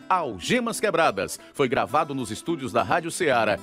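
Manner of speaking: ordinary delivery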